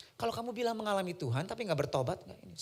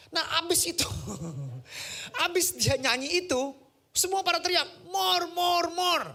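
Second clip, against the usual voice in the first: high, loud crowd cry of more; rising excitement, calling out more; hammed-up crowd chant of more; excited retelling; 12 semitones higher, 5 dB louder than usual. Preacher excitedly retells crowd shouting.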